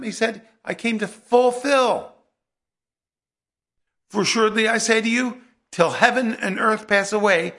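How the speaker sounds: loud, ringing pulpit proclamation; full-force preaching. Acted. Preacher proclaiming Jesus' words on the law, loud and fervent. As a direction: preach it out to the back row.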